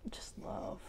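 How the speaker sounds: hushed, breath-only whisper; whispering, overcome with feeling; real whisper. Real, not acted. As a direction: let it drop away into a whisper. Emotional, answering the card's question.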